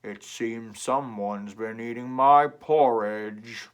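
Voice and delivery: loud, slow booming papa bear; deep Papa Bear. Acted. Papa Bear finding his porridge eaten, loud and slow. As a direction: boom it out slowly, stressing my. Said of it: "and said".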